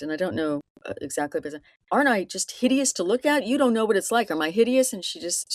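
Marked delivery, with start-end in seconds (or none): 1.9-4.9 mildly heated, higher-pitched quoted plea; acted-out hurt anger, not shouted; retold angry outburst. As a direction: imitate an angry man demanding an answer, pitch raised. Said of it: "he gets very angry".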